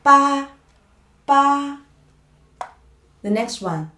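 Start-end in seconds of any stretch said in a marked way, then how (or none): 0.0-1.8 "Ba" high and level; flat tone. Shown as a demonstration. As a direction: hold "Ba" on one even high pitch. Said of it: "The tone is flat".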